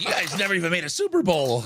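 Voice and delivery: imitating an indignant fan, high and quick; mad fan. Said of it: "one guy who was mad".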